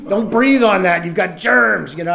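mock yell. Guarding his aquariums, mock yelling at the woman he lives with. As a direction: bark it like a possessive fish-tank owner. Snapping at partner over fish tanks.

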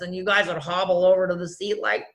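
normal talking voice

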